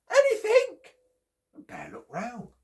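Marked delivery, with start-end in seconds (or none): 0.1-0.6 very high, loud squeaky call; chipmunk shouting up. Acted. Chipmunk shouting up to Bear, very high and loud. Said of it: "he shouted up".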